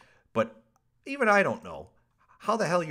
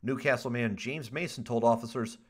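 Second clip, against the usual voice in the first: usual speaking voice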